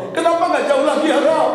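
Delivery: high, wailing imitation of the husband; retold tearful plea. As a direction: cry it to God, pitched right up. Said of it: "suamiku berkata sambil melihat ke atas menangis".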